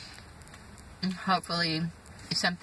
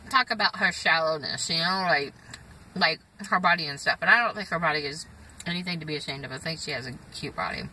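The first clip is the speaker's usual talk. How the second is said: normal talking voice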